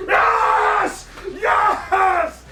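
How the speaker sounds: high, loud yelling yes; excited shout. Real, not acted. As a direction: shout for joy, full voice. Finally gets the blues she wanted.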